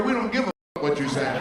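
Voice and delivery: laughing. Acted. Mocking American attitude, laughing.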